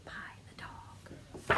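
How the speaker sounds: dramatic whispered reveal. Acted. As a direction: hush it like a secret. Teacher reveals story ending.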